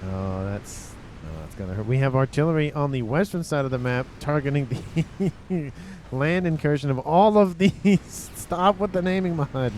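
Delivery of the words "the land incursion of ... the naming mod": laughing. Real, not acted. Laughing at player names.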